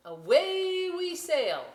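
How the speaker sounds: high and slow, sailing off; excited sing-song. Acted. Reader launching the story, high and slow. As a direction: lift it up like a cheer to set sail.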